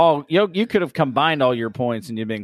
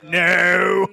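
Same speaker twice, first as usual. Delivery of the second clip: shouted no; 5 semitones higher than usual. Retold or imitated. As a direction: bark the refusal. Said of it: "He said".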